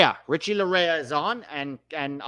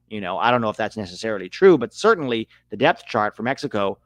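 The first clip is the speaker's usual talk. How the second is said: ordinary talk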